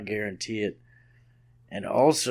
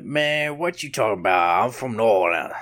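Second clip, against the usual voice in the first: put-on New Orleans accent. Podcaster answering imagined critics, New Orleans accent. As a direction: play an indignant New Orleans local. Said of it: "I'm like".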